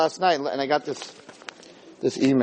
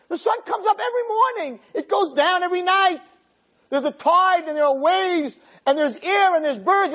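preaching. Acted. Rabbi preaching to the man that God is not on trial. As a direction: preach it out with full force.

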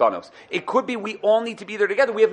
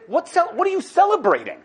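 mock astonished Pharaoh; 10 semitones higher, 3 dB louder than usual. Pharaoh demanding of Moshe what holiday this is, high and incredulous. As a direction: pitch it up in mock disbelief. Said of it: "he looked at Moshe and he said".

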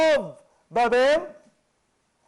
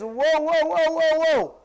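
loud, higher string of protests; alarmed halting; 4 semitones higher, 6 dB louder, slower than usual. Retold or imitated. Nebuchadnezzar stopping the letter, alarmed. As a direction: cut in to halt everything.

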